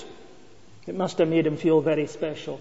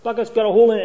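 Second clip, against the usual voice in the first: lightly voiced lady calling from her garden, a bit higher; 4 semitones higher, 3 dB louder, faster than usual. Lady calling from her garden to Wally, lightly voiced. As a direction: call out to Wally, a touch higher and louder. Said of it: "she cries from her garden".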